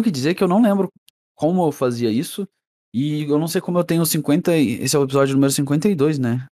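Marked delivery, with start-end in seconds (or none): none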